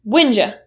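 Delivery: Whenja with the you turned into ja; reduced form. Shown as a demonstration. Teacher modelling when did you as whenja. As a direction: say Whenja as one word, J sound. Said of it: "The U turns into J".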